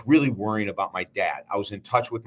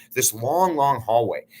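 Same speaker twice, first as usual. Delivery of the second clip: ordinary talk, nothing marked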